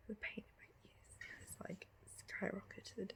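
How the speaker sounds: faint, breathy pained whisper; barely voiced through a sore throat; weak whisper. Real, not acted. Sore throat after surgery, weak voice.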